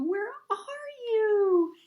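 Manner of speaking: slow, high, loud drawn-out cry; long echoing cry for Papa; desperate call. A narrator's reading. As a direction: stretch the call across the dark sea. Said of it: "cried Little Blue".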